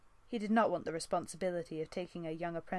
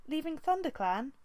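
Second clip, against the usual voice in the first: higher, louder rising echo of disbelief; dismayed echo; 10 semitones higher, 4 dB louder than usual. Acted. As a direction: repeat it in dismay. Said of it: "echoed in dismay".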